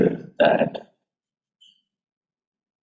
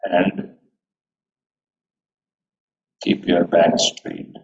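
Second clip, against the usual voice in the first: guided meditation. Acted. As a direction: guide them gently into stillness. Leads a meditation.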